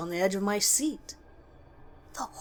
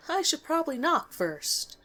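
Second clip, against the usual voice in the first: light, higher joking tone to break the nervous silence; joking to ease tension; 8 semitones higher than usual. A narrator's reading. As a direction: toss it off as a nervous joke. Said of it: "attempted to lighten the mood with a laugh".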